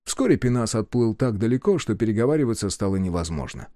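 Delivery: ordinary delivery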